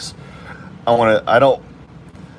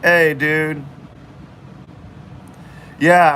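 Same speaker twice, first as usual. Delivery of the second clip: normal talking voice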